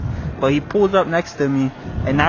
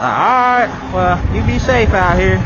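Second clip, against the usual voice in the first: put-on higher, drawn-out southern old-timer; old man, southern; 6 semitones higher, 5 dB louder than usual. State trooper sends the rider off safely, imitated southern old man. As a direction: imitate an old country officer. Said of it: "talking like an old man".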